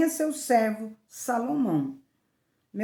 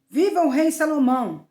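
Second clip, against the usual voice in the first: raised, louder royal acclamation; proclaimed shout; 6 semitones higher, 6 dB louder than usual. A narrator's reading. King Davi ordering what to shout, proclaiming loudly. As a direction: call it out higher and louder, briefly. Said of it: "e gritem".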